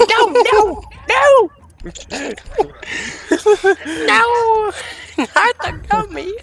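high-pitched mock wail, laughing; mock horror. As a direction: shriek in playful horror. Mock horror at gummy puns.

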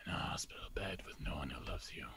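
low cold whisper; menacing whisper. Acted. Menacing a dying mother.

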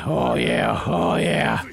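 very low, throaty drawn-out approval; hammed-up relish. Player relishing an all-you-can-eat buffet, deep mock pleasure. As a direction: drop deep and savour it.

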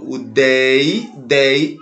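"dei" held long and repeated; single out "dei" slowly as the strong syllable; stressed syllable isolated. Shown as a demonstration. Teacher points out the stressed syllable, "dei" drawn out. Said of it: "é a sílaba mais forte".

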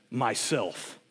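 low and soft, heavy; very deep and low. A narrator's reading. The lion answering the boy, very deep and low. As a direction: speak it from deep in the chest. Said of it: "very deep and low so that the earth shook".

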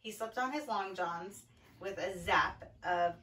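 low storybook narration, slowing at the end; reading to children. A narrator's reading. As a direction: settle the story toward bedtime. Reads picture book to children.

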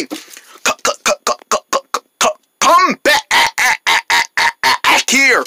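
stuck-machine stutter; robot pig. Acted. Pig ordering rabbit back, robotic stutter. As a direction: chop words into repeating robot syllables. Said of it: "Why is she acting like a robot?".

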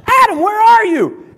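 loud, high call across the garden. Acted. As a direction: call for him as if lost. God calling out again.

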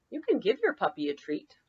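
ordinary delivery

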